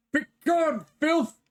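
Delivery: high angry ghostly cry; shrieking spirit. Acted. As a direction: shriek it like a cursed voice. Coat spirit shouts.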